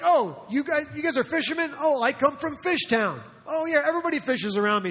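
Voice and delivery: light up at finding common ground; excited discovery. Acted. Philip finding out the group are fishermen, excitedly.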